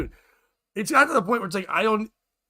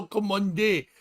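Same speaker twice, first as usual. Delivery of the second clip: Jamaican lilt, slightly raised pitch; Jamaican accent; 4 semitones higher, slower than usual. Retold or imitated. Puzzled at the co-host's singing, Jamaican accent. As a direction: repeat it in mock Jamaican accent. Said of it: "it sounded Jamaican".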